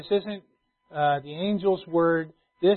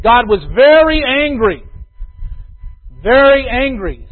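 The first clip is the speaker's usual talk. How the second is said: "very" punched, louder and higher, slow; stress on very; 6 semitones higher, 12 dB louder, slower than usual. Acted. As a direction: lean hard on "very". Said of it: "emphatic repetition".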